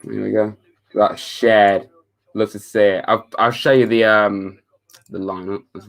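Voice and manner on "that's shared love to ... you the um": ordinary talk, nothing marked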